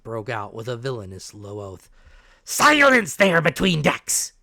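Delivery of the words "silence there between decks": a harsh roar, loud and dragged out; roaring captain. Acted. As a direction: bellow it down at the whole room. Captain roars at the doctor.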